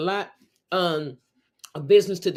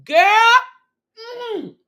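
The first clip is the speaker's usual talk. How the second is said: put-on high, loud delighted "Girl"; friend's excited high shout; 9 dB louder than usual. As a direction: call it out thrilled and high. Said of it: "Leslie said".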